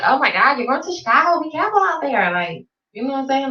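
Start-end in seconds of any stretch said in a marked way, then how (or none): 0.0-2.3 higher, fussy alarm over her trip; hammed-up overprotective concern; mock worried warning. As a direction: imitate people fretting about Chicago. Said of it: "they try to make it seem like".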